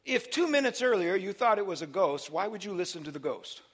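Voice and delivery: normal talking voice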